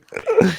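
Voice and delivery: squealing high laugh. Real, not acted. Laughing at his own joke.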